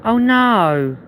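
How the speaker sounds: high, exaggerated oh no; hammed-up alarm; mock dismay. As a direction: pretend the cake is doomed.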